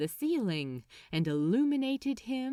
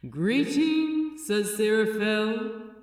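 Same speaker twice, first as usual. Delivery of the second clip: loud, cool, commanding heavenly voice; cool voice of the Metatron; 5 dB louder than usual. A narrator's reading. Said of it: "said the cool voice of the Metatron".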